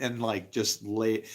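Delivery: usual speaking voice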